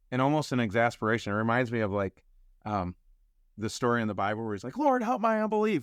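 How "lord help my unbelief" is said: high blurted cry for help; high desperate blurt; blurted plea. Retold or imitated. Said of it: "he, like, blurts it out".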